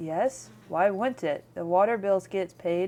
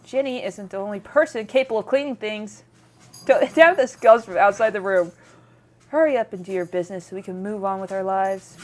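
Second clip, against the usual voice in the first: ordinary delivery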